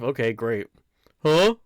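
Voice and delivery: ordinary talk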